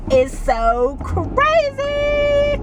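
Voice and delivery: high, slow stretched crazy; exasperated sing-song about the weather; drawn-out exasperation. Real, not acted. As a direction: drag it out in disbelief.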